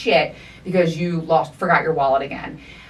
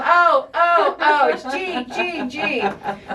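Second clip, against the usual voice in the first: higher, hammed-up pleasure sounds; mock moaning; 8 semitones higher, much slower than usual. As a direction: play it as an over-the-top moan, lightly.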